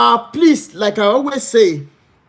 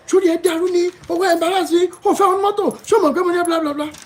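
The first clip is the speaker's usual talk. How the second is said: pitched-up mock rant at an embarrassing son; retold angry scolding; 11 semitones higher, much faster than usual. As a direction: imitate a single mother scolding her son in the car.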